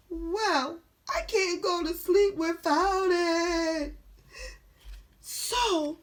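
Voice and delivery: high, loud, whiny wail; upset character. Acted. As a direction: whine it out high, loud and stretched. Upset character resolves to search.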